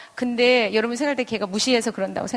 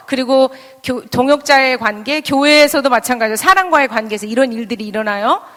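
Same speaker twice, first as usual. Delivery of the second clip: ordinary talk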